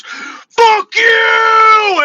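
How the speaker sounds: high, loud drawn-out yell; mock-furious high shout; imitating furious driver. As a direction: yell it like an enraged stranger, voice way up. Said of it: "he was so mad".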